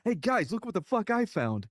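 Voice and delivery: high, goofy showing-off voice; proud lieutenant. Retold or imitated. Lieutenant waving the trophy at the other guys, high and proud. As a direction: crow about the find like a kid.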